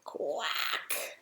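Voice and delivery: one sharp imitated quack; angry duck quack. A narrator's reading. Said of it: "the quack of an angry duck".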